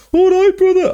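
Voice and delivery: a hammed-up Birmingham 'all right, brother'; bad Brummy accent. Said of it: "that's supposed to be a Brummy accent".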